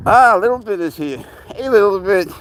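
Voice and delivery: high, loud cooing at the kitten. Real, not acted. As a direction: coo over the little one.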